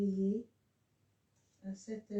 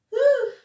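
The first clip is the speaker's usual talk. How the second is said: loud whew; 15 dB louder than usual. Real, not acted. Relief after confusion.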